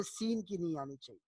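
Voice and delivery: ordinary delivery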